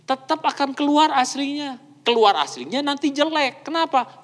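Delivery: ordinary delivery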